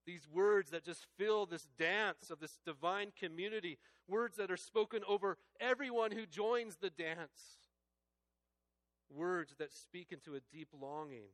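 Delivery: loud, high, impassioned preaching; rising fervent sermon climax; full-force preaching. Real, not acted. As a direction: lift it up and proclaim each question. Sermon swells again.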